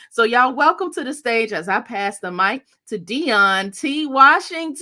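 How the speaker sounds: building to a loud, high announcer call on the name; rising hype as she passes the mic to her guest; hyped stage introduction. Real, not acted. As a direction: bring him out like a host hyping the crowd.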